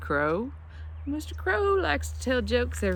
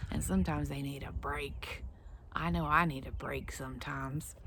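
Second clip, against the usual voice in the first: whisper; 7 semitones lower, 9 dB quieter than usual. Acted. Teacher hushes about needing breaks.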